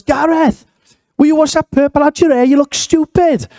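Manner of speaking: very high, mock scolding screech; screaming grandmother. Grandmother telling off her grandson at his wedding, mock screaming. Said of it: "I remember screaming".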